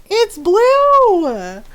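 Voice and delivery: high, loud "It's blue" in disbelief; exasperated shout. Retold or imitated. Insisting to friends who call it purple, loud and exasperated. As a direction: yell it like it's obvious. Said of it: "I'm just like".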